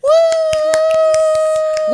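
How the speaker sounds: long, very high celebratory "woo"; sustained full-volume whoop; loud high whoop. Real, not acted. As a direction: let out a piercing drawn-out cheer. Celebrating a teased announcement.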